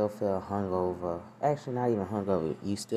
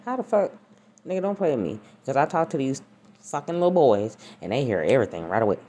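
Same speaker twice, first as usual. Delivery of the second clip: loud, high indignation; indignant; 6 semitones higher, 8 dB louder than usual. Real, not acted. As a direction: push back loud and offended. Indignant rant at daughter.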